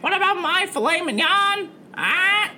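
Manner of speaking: squawking, complaining parrot; Pickles. Acted. Parrot scolds captain over forgotten lunch, squawking. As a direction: screech it like a hungry pet bird. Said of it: "Pickles the parrot".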